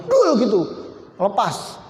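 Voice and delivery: ordinary talk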